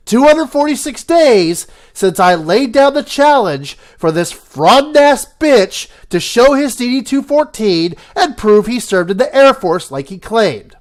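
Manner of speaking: normal talking voice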